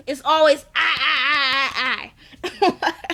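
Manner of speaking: mocking her I, I, I, laughing; half-laughing mockery of a selfish friend; mocking whine. Retelling a sister mocking Mo's selfishness, laughing. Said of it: "I'm just really sick".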